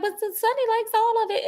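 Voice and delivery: high, small voice; quoting Vea. Retold or imitated. Vea pleading with Sunny's dad, pitched high. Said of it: "And she was like".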